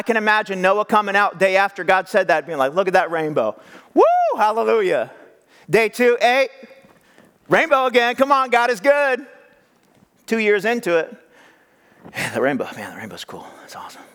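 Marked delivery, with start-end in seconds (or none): 2.7-9.3 higher, excited Noah cheering; thrilled. Retold or imitated. 12.2-14.0 flat, much softer, bored Noah; bored, unimpressed. Retold or imitated.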